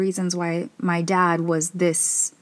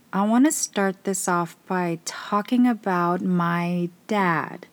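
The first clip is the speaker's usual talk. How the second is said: normal talking voice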